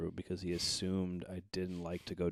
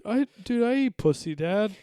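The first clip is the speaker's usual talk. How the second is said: loud mock protest; 11 dB louder than usual. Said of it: "I was like".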